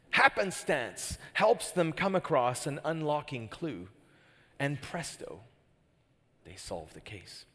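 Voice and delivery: ordinary talk, nothing marked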